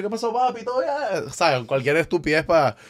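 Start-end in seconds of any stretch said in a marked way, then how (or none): 0.0-1.2 joking. Retold or imitated. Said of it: "Yo jodiendo lo hubiese dicho".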